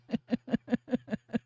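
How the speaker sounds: high, soft fit of giggles; real, breathless-sounding laughing fit; helpless laughter. Real, not acted. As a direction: keep giggling, too tickled to talk. Cracking up over pickle joke.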